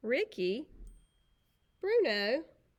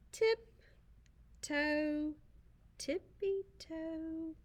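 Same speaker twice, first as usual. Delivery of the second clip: dainty tiptoe; 8 semitones higher, 3 dB quieter, much slower than usual. Acted. Poodles tiptoe gracefully.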